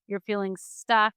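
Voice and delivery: ordinary talk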